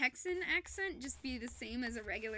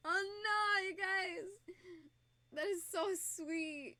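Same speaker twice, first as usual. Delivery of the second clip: high tearful voice; moved to tears, voice pitched way up; crying, touched; 7 semitones higher, slower than usual. Real, not acted. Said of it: "so you can actually see me crying".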